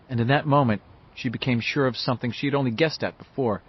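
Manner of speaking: plain reading voice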